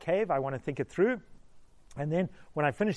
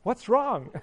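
surprised what's wrong; 7 semitones higher, 5 dB louder than usual. Retold or imitated. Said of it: "I'm like".

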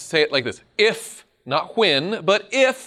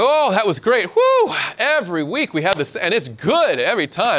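gushing excitement; 5 semitones higher, 5 dB louder than usual. Acted. Angels gushing after worship.

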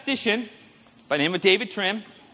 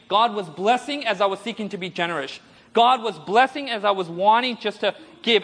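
usual speaking voice

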